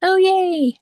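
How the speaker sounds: high, bright little cheer; excited. Real, not acted. Hears husband is coming home.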